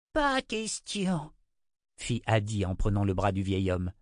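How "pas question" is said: slow, very high character voice; firm refusal. Acted. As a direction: draw out the refusal. Woman flatly refuses to go.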